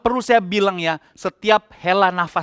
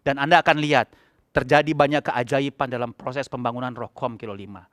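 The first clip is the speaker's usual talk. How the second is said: ordinary talk, nothing marked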